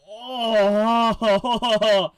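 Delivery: loud drawn-out oh; disgusted groan. Real, not acted. Vlogger recoiling at the face peel. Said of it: "reacting to gross scene".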